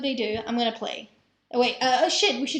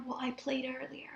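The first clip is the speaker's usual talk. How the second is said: stage whisper; 10 dB quieter than usual. Pretend. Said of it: "I should do a stage whisper so they can hear".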